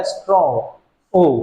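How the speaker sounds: "स्ट्रॉन्ग" with its vowel picked out, then "ओ" alone; "ओ" repeated separately after "स्ट्रॉन्ग"; vowel sound demo. Shown as a demonstration. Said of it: "केवल एक बार वावेल sound आया".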